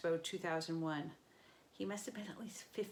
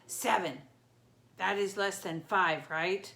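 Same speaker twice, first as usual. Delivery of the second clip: dim booming monster; 8 dB louder, slower than usual. Acted. Dim Cyclops bargaining.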